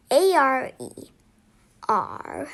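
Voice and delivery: plain reading voice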